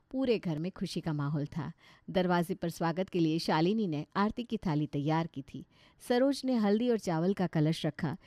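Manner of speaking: usual speaking voice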